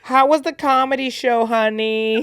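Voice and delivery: high, loud motherly voice; mock mom. Friend joking that the Uber driver was a mom, mock sweet mom voice. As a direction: play a sweet suburban mom.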